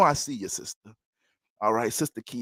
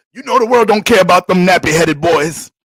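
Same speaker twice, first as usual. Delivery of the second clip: loud, forceful declaration, a bit higher; hard father; 5 semitones higher, 13 dB louder than usual. Retold or imitated. Father insisting to his wife, loud and forceful. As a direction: bark it at her like a tough father. Retold father defending tough love.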